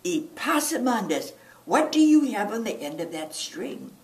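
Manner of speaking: high, loud, exasperated mother; exasperated call. Acted. Mama confronting her son about the bread, loud and high. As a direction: call him out like a fed-up mama.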